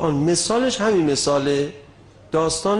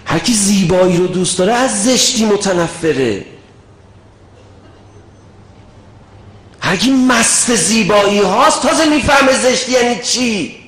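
preaching at full force, higher and louder, shouting; fiery preaching; 9 semitones higher, 9 dB louder than usual. Real, not acted. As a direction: thunder it at the congregation. Fiery sermon climax.

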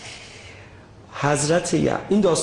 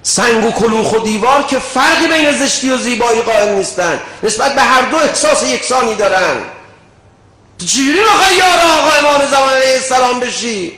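preaching at full force, higher and louder, shouting; fiery preaching; 9 semitones higher, 9 dB louder than usual. Real, not acted. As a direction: thunder it at the congregation. Fiery sermon climax.

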